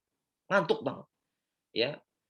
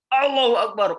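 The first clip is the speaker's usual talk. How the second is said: loud, raised cry of praise; retold takbir; 6 semitones higher, 6 dB louder than usual. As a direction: call out the takbir in retelling. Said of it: "Begitu takbir". (seruan takbir yang lantang)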